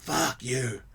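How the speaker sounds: put-on drunk old man. Drunk man refuses help, drunk dismissal. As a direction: mumble the insult like a drunk.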